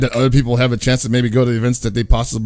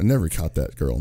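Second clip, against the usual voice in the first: ordinary talk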